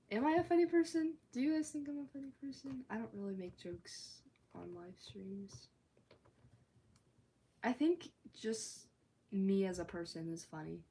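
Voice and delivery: usual speaking voice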